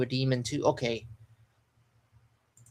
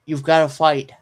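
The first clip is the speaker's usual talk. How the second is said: louder, pleading you've gotta fight; urgent plea; 3 semitones higher, 7 dB louder than usual. Acted. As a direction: push it out like a desperate call to a friend. Reading Chopper's urgent line aloud.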